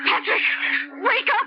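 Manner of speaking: wife calling her sleeping husband awake; urgent wake-up call. Real, not acted. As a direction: shake him awake with your voice. Wife wakes moaning husband.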